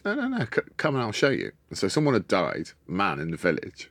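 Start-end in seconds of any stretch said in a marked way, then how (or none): 0.0-1.5 high put-on old lady voice; Nine. Aunt eagerly inviting the visitor in, high and sweet. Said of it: "She goes".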